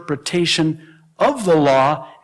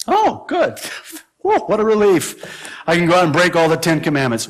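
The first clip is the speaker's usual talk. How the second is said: mock relief; 2 semitones higher, faster than usual. Preacher voicing a relieved listener, mock delight. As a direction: play the gleeful listener, then catch yourself.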